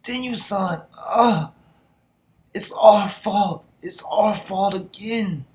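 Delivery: high, loud, slow cry of guilt; hammed-up grieving soldier, slowed down; anguished plea. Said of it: "Kanto's like".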